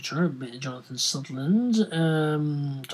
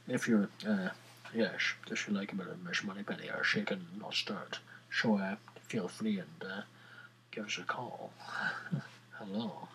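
put-on low, hushed, suave Scottish spy accent; 5 semitones lower, 8 dB quieter than usual. Podcaster wooing listeners with Bond references, in a suave Scottish accent. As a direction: purr it softly in a hammed-up Scottish accent. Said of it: "But, uh, not quite Scottish".

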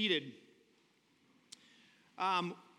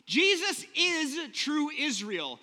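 laughing through the words after a slip, higher and louder, slow; 7 semitones higher, 5 dB louder, slower than usual. Real, not acted. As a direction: correct yourself while still laughing. Laughs at own slip of tongue.

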